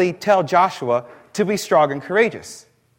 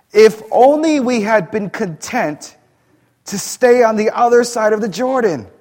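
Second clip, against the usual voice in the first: higher and louder, a wailing complaint; despairing lament; 5 semitones higher, 5 dB louder than usual. Acted. Joshua wailing to God after defeat, higher and louder. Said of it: "And Joshua said".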